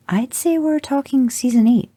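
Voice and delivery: put-on high, eager boy voice; know-it-all kid. A narrator's reading. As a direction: show off the fan knowledge like a young son.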